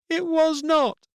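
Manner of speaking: put-on a girl insisting she was wronged; upset little girl. A narrator's reading. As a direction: daughter blaming the boy in a quarrel. Children quarrelling.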